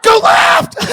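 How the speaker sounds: high, loud cheering at the screen; excited gamer shout. Acted. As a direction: yell like it's a video game. Cheering delivery car like game.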